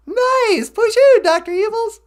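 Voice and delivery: chirpy robot sidekick offering a ride home; high, squeaky sidekick voice. Pretend. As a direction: squeak it like a tiny robot pal. Voicing the companion.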